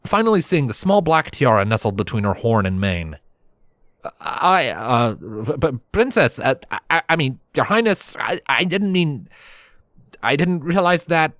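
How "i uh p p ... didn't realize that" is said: flustered stammer with raised pitch. Acted. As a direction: trip over your words before royalty. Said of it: "Her guard snickered over my stammering".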